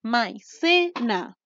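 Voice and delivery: MAICENA very slowly, split as mai, ce, na; teacher sounding out MAICENA as mai-ce-na; syllable by syllable. Shown as a demonstration. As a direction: break MAICENA into three drawn-out syllables. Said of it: "Tenemos tres sílabas".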